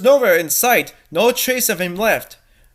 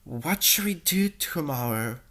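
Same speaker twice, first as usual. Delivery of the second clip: whispering; 5 semitones lower, 10 dB quieter than usual. Acted.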